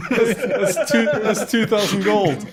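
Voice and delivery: high, loud, laughing disbelief; laughing excitement. Real, not acted. Player adding up the rubies' worth, laughing excitedly. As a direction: blurt it out through real laughter, stammering. Delighted at a huge treasure find.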